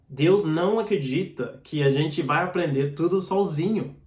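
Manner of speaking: ordinary talk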